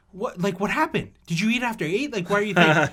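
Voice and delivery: imitating a panicked mom; mock mom scolding. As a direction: scold the kid like an alarmed mother. Mom scolding child after vomiting.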